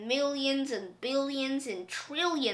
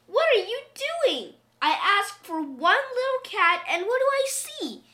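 put-on high, loud scolding old woman; high-pitched, dismayed questioning; old woman crying out; 8 semitones higher, 4 dB louder than usual. A narrator's reading. Old woman scolds husband over the cats, high cry. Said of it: "she cried".

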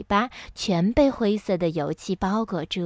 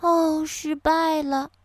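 slow, higher-voiced fairy, a touch dejected; 6 semitones higher, slower than usual. A narrator's reading. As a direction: sigh out mild disappointment, slowly. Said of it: "仙女沮丧地说". (略带沮丧，慢慢地说)

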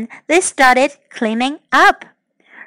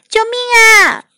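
calling for help; 10 semitones higher, 4 dB louder than usual. A narrator's reading. Amanda calls for help from the bubbles, high cry. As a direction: cry out for rescue in a raised voice. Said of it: "阿曼达叫了起来".

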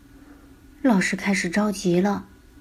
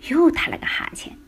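yawning; 8 semitones higher than usual. A narrator's reading. Principal yawning.

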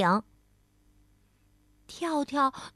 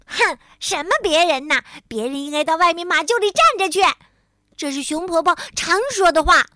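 fast, higher, louder comeback; huffy retort; 5 semitones higher, 7 dB louder, faster than usual. A narrator's reading. Tiaotiao throws Grandma Bear's saying back, huffy. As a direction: scoff and snap back. Acted quarrel, snappy retort.

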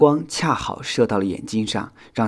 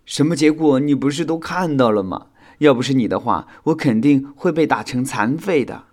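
louder, higher glum complaint; 5 semitones higher, 6 dB louder than usual. A narrator's reading. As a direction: grumble about a bad outcome. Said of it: "修想郁闷地说道". (郁闷地抱怨)

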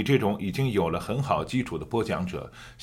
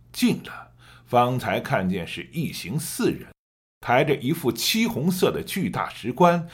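story narration; 3 semitones higher than usual. Shown as a demonstration. Teacher demonstrating the narration: rising tension. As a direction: draw the listener toward the approaching riders. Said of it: "我可以给你做一个演示".

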